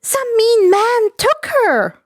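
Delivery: put-on high, bouncy girlish voice; bubbly schoolgirl on the phone; chirpy schoolgirl. Himiko chatting to the hero, high bubbly girl voice.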